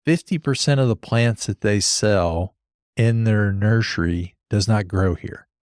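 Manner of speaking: normal talking voice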